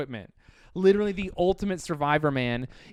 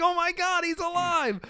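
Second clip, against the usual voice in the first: high, shrill-pitched mock scream of panic; 3 dB louder than usual. Mounties seeing the trapper survived, hammed-up panicked squeal. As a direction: squeal it like a terrified cop, hammed up.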